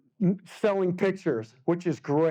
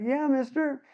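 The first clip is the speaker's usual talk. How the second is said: put-on kid's voice; 8 semitones higher than usual. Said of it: "She's like".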